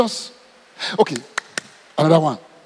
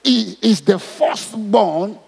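"He is the firstborn" drawn out very slowly, each word weighted; slow, deliberate reading; much slower than usual. Shown as a demonstration. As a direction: read it again slowly so every word lands. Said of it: "Go back".

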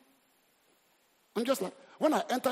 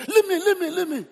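loud, higher mock outburst at wife; angry retort; 9 semitones higher, 8 dB louder than usual. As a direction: snap back at your wife in a temper.

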